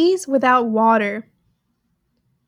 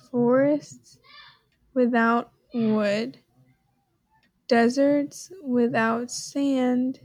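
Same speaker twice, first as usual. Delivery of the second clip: slow musing; 4 dB quieter, much slower than usual. Real, not acted. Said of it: "puzzling over riddle slowly".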